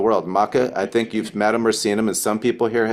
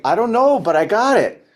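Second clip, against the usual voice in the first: much higher, bright-eyed mock clueless voice; clueless new recruit; 10 semitones higher, 4 dB louder than usual. Recruit answering a friend's question in a high, giddy clueless voice. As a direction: play the giddy newbie who has no idea.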